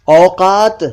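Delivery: usual speaking voice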